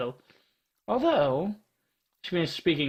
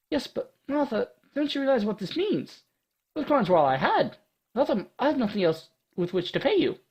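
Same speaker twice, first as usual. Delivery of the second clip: put-on high, anxious girl voice; a young adventurer fretting; young heroine voice; 7 semitones higher than usual. Voices Ada in a story.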